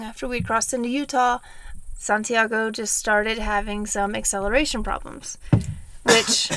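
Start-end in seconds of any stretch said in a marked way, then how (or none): 6.0-6.5 coughing and choking mid-sentence. Real, not acted. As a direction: talk through a choking cough. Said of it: "Sorry, choking".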